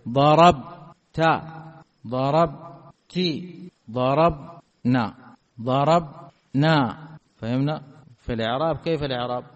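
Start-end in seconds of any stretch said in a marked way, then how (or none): 0.1-1.2 ضربت drawn out slowly and loud, falling at the end; stretched, loud demo. Shown as a demonstration. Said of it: "طيب". 2.1-3.2 ضربت drawn out very slowly at normal level; very slow demo. Shown as a demonstration. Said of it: "طيب". 3.9-7.0 ضربنا drawn out slowly, falling at the end; slow demo. Shown as a demonstration. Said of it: "طيب".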